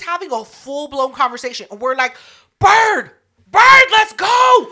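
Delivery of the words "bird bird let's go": high, loud, drawn-out call across the lot; yelling. Retold or imitated. Friends yell at Bird from the parking lot, loud and drawn out. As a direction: holler her name slowly from outside. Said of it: "We're all yelling".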